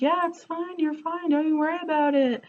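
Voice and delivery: mocking the boyfriend's offhand reassurance; dismissive boyfriend. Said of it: "And he's like".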